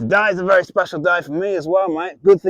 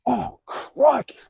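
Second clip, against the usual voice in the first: surprised; 8 semitones higher, much slower than usual. Acted. Finds the bearded dragon.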